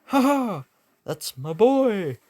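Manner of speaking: high, gleeful boast; acted triumphant glee; proud private cheer. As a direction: crow proudly, stretching the words out. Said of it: "thinking to himself".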